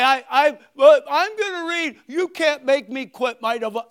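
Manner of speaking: loud, high mock defiance; defiant protest. Preacher mimics a congregant protesting loudly, hammed-up. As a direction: shout back like a stubborn believer refusing to quit. Mocking a reluctant churchgoer.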